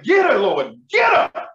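praying she gets pulled over; desperate prayer. Retold or imitated. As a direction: beg the Lord for the cops to stop her. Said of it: "I pray, I was like".

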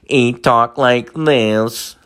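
slow robotic computer voice; Stephen Hawking. Shown as a demonstration. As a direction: talk like a speech machine, evenly spaced. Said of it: "Through his computer and shit".

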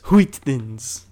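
loud cartoon voice on "Wheat thins"; Stewie Griffin. Friend echoes "Wheat thins" as a loud cartoon impression. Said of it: "trying to perfect my Stewie Griffin voice".

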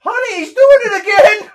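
piercing high holler; mock parental yell. Parent interrupts to report crying son, hammed-up yell. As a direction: shout it at full volume to a spouse. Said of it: "He just keeps yelling that out".

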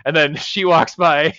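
usual speaking voice

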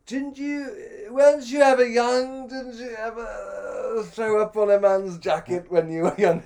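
high, loud, hammed-up plea; mock pleading. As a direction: plead like a wronged, tipsy youngster. Acting his own drunken pleading.

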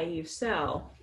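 ordinary talk

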